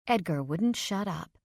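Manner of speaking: usual speaking voice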